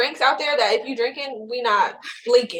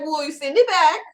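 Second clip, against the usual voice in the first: sassy date; 9 semitones higher, 6 dB louder than usual. Retold or imitated. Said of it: "I'm gonna be like".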